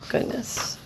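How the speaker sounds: breathy hushed gasp of a word; whispered aside. Real, not acted. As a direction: whisper it, dismayed. Reader's aside.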